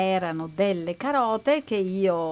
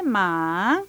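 usual speaking voice

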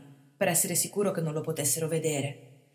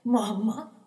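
pained cry; 5 semitones higher, 4 dB louder than usual. A narrator's reading. Wounded boy crying out.

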